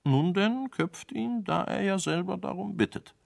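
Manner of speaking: high, sneering royal order; mocking king. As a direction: toy with him as you order the beheading.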